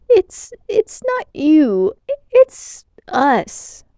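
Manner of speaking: high, slow, quavering apology; trembling voice. A narrator's reading. Helix apologizing to armed Grygon, trembling with fear. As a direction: stammer it out terrified. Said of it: "his voice trembling".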